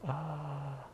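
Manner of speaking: mock freak-out wail. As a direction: wail like a kid having a tantrum. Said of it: "he's freaking out".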